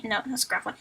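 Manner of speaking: ordinary delivery, nothing marked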